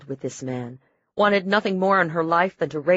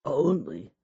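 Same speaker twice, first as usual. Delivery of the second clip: gurgling; 3 dB quieter than usual. A narrator's reading. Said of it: "he tried, blood gurgling in his throat".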